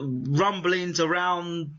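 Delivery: ordinary talk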